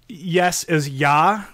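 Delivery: ja drawn out slow, German style. Shown as a demonstration. Showing the German word for yes, ja slowly. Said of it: "I know, like".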